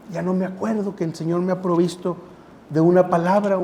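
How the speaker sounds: normal talking voice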